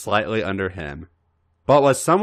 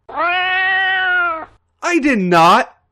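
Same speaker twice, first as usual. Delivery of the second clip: high, loud, drawn-out protest of not; indignant exclamation; 7 dB louder, much slower than usual. Acted. AJ denying Raven's joke, long high indignant cry. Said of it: "A J exclaimed further".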